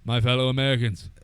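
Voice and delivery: lightly mock a presidential address; a touch of grand old-time president; JFK impression. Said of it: "Can you imagine J F K?".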